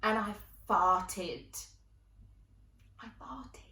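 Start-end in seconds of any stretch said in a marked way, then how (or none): 3.0-3.6 hushed, embarrassed whisper; telling the audience in an ashamed whisper; whispered confession. Real, not acted. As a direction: let it slip out under your breath.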